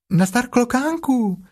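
very high, drawn-out greeting; high little animal hailing a friend; Piglet calling out. Acted. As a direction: call up in a tiny piglet voice. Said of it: "zvolalo prasátko".

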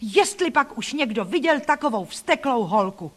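high, scandalised outcry; loud indignant cries, easing off toward the end; shocked exclamation. A narrator's reading. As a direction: exclaim in outrage at the child's behaviour. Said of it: "jak vykřikli".

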